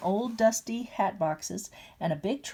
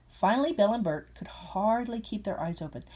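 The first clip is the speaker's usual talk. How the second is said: ordinary delivery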